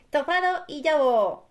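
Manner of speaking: high, slow little sheep voice; smiling little sheep. A narrator's reading. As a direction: say it sweetly with a smile, drawn out. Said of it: "dijo la ovejita sonriendo".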